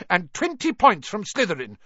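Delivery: high, fast, loud scolding; furious teacher scolding pupil out at night; angry shouting. A narrator's reading. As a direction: tear into him with outrage. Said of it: "she shouted".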